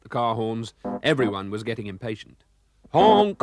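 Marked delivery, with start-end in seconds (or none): 2.9-3.4 put-on a high, loud honk like an old car horn. Narrator imitates Gumdrop's horn in traffic, high loud honk. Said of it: "honk went another horn".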